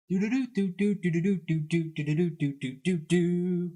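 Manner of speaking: quick run of "doo" trumpet toots; mouth trumpet imitation. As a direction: mimic drunk girls playing a pretend trumpet. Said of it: "their version of the trumpet".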